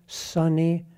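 usual speaking voice

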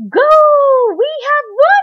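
very high, loud cheer; victory shout. A narrator's reading. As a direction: shout it as the winning team. Winning team celebrates victory.

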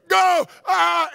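high, loud yell of "go"; launching yell. Retold or imitated. Preacher reenacts jumping onto a zip line, launching yell. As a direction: yell it out as you take off.

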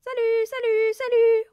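high, ringing parrot call; loud clear parrot. A narrator's reading. Unseen parrot heard from the dining room, loud and clear. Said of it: "reprit la voix".

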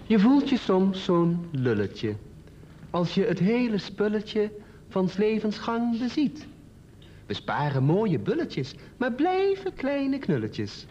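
slow, measured verse reading; poem recital. Acted. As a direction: draw out each line, pausing between them. Reads own poem aloud.

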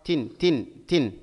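normal talking voice

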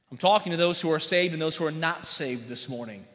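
normal talking voice

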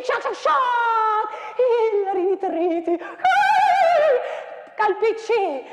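declaimed with wild swings of pitch and loudness; dramatic poem recital. Acted. Teacher performing the battle poem for students, declaimed.

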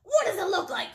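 high, loud, irritated retort; exasperated bird losing patience; snapping. A narrator's reading. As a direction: throw the question back crossly. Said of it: "snapped Bird".

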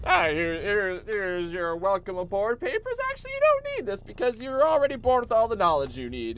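a cheerful clerk handing over papers; lightly voiced chipper official; cheery clone official.

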